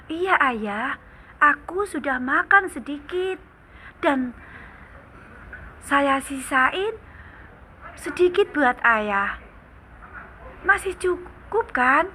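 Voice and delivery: put-on high, light little boy voice; child voice. Child answers his father.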